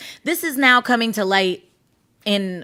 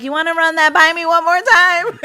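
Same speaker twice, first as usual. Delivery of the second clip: high and loud mock stern parent; a parent demanding the kid repeat that; hammed-up disbelieving parent; 8 semitones higher, 8 dB louder than usual. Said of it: "their parents are like".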